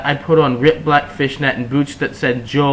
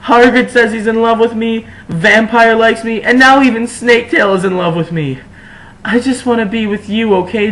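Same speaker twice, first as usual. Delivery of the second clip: high, loud whiny shout; high, loud vain complaint; shouted angrily; 9 semitones higher, 4 dB louder than usual. A narrator's reading. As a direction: complain at the top of the voice. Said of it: "I shouted angrily".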